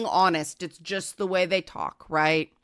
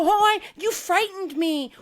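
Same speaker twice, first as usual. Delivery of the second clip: high, breathy-sounding fake fright, mocking; mock frightened; 9 semitones higher than usual. Mocking the other person who claims to be scared. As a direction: ham up a startled victim act. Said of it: "the other person will go".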